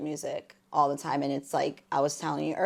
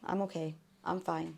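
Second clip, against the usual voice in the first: soft, composed reassurance, acted lightly; composed outside; 10 dB quieter than usual. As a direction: quietly pretend to be fine. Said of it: "you're composed on the outside".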